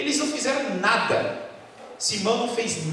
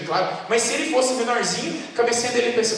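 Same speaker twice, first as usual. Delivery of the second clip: ordinary talk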